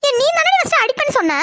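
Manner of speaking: high pitched indignant accusation; shrill accusation. Acted. Girl blaming her sister in a fight, high indignant cry. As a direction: throw the blame back high.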